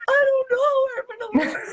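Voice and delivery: high, wailing mock cry; hammed-up sob of someone falling apart; mock sobbing. Divorcee answering a church member, mock sobbing wail.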